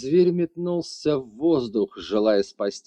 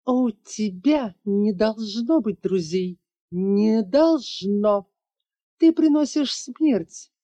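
high, slow, spiteful villainess; drawn-out cruel sneer; cruel hiss; 10 semitones higher, slower than usual. A narrator's reading. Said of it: "цедила жестокая женщина".

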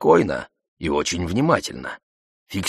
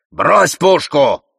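loud, slow, raised-pitch order; shouted command; 8 semitones higher, 6 dB louder, much slower than usual. A narrator's reading. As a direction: order an armed man to disarm, drawing it out. Gunman ordered to drop weapon. (громкий протяжный приказ)

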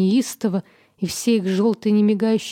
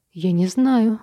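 plain reading voice

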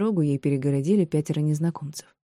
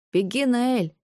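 put-on raised, high-pitched boy's shout; boy calling out; 8 semitones higher, much slower than usual. A narrator's reading. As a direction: call out to a friend like a young boy. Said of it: "крикнул он".

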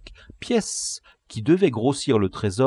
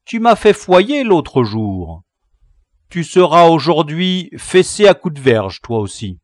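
loud, higher, threatening; vengeful boy leader; 6 semitones higher, 9 dB louder than usual. Acted. Gang leader to captive, loud vengeful threat. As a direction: pronounce the sentence loudly on your captive.

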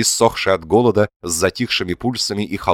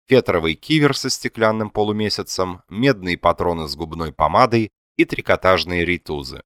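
usual speaking voice

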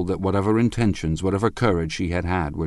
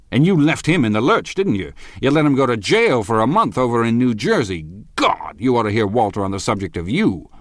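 raised, jabbing charges; angry accusation; 5 semitones higher, 4 dB louder than usual. Acted. Husband accusing rival, loud and angry. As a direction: throw the jail at him.

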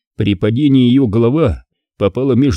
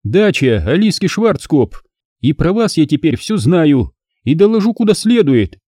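high, mocking woman's voice; scornful blonde vixen jeering; sarcastic female voice; 6 semitones higher than usual. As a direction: sneer it down from above. Alisa mocks from above.

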